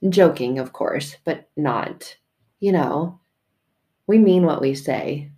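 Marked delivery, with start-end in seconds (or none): none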